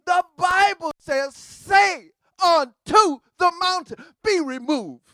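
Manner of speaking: high, loud shout of command; preaching shout. Real, not acted. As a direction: thunder it out to the congregation. Preacher commanding the mountain to move.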